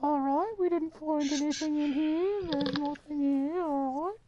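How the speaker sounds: very high, slow, squeaky mock British voice; hammed-up British explorer, falsetto; mock British gamer voice. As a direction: parody a Brit leading the group. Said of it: "actual, like, American voice".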